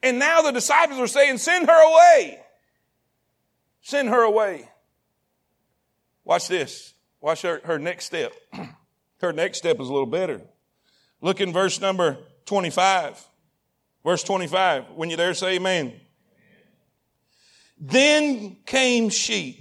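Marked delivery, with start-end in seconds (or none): 1.4-2.4 raised, louder mock dismissal; high, forceful acted order; acted demand. 17.8-19.6 very slow, stretched verse reading; slow, deliberate scripture reading; drawn-out reading. Real, not acted.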